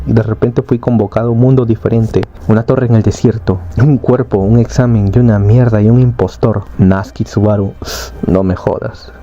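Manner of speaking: usual speaking voice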